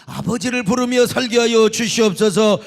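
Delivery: crying out to God; tearful loud prayer. Retold or imitated. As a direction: pour out the prayer in tears at full voice. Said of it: "눈물을 흘리며 노래를 하고".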